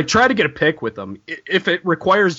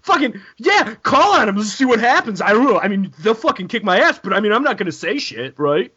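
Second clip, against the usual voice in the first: mock panicked God; 5 semitones higher than usual. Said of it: "He's like".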